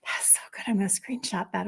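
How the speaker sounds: soft breathy aside; whisper. Real, not acted. Host touched by a comment, whispered aside.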